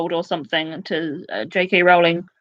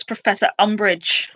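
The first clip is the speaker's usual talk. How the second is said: ordinary delivery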